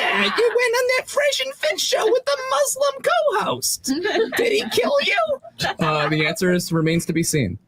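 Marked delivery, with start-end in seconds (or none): 0.0-5.4 high shouted nagging mother. Retold or imitated.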